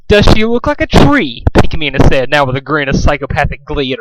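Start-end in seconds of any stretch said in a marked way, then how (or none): 0.1-1.4 high, loud gleeful menace; gleeful psycho. A narrator's reading. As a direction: ask it with psychopathic glee. Psychopathic glee.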